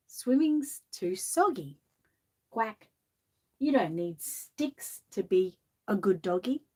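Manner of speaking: lilting storybook read-aloud; gentle sing-song picture-book reading; reading to children. Acted. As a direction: read the rhyme like bedtime for kids.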